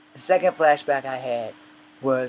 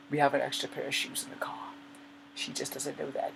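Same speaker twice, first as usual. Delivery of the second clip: conspiratorial whisper; 9 dB quieter than usual. Real, not acted. Conspiratorial aside about wife.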